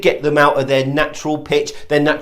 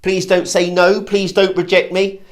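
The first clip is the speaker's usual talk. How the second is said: higher, hopeful pleading; needy, raised questioning tone; pleading hopefulness; 3 semitones higher than usual. Shown as a demonstration. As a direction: plead as if braced for rejection. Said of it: "Can you hear the hopefulness?".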